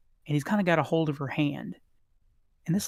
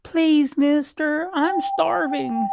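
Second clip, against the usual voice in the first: put-on high, slow pleading child voice; little elf girl begging the merchant; starving little girl; 13 semitones higher, 6 dB louder, much slower than usual. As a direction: beg the shopkeeper, drawn out. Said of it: "she says to the shopkeeper".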